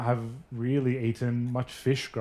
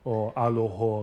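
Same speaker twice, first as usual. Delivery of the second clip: alo ho said with a northern Lebanese Syriac O; slow Syriac-flavoured alo ho; Syriac-accented Lebanese; slower than usual. Shown as a demonstration. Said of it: "the ho".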